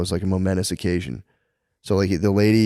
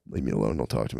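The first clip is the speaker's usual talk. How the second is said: lower, softer grumble, waving people off; grumpy old bureaucrat; 9 semitones lower, 8 dB quieter, faster than usual. Retold or imitated. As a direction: brush off fans in a low grumble. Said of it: "He's like".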